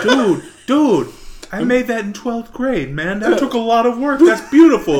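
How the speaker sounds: hammed-up wounded objection; mock-hurt protest. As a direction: plead for your precious handiwork. Acted protest at breaking his crucifix.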